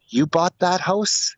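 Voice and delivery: a hint of an old lady, drawn out; old lady asking. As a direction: ask it like a curious old neighbour woman. Old lady approaches new owner.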